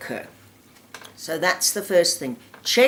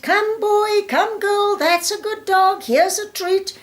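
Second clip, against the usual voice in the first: much higher, bright happy calling to the dog; nice upbeat call; 12 semitones higher than usual. Shown as a demonstration. Trainer calling the dog back in a park, upbeat and much higher. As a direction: coax the dog back with an upbeat, sing-song recall. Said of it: "A nice, upbeat".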